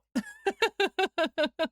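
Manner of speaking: high, running giggle; real amused laughter. Real, not acted. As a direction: burst into high-pitched laughter. Laughs at chat's accent comment.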